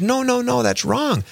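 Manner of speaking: sister's high-pitched protest, acted out; imitated objection. Acts out sister objecting to reading.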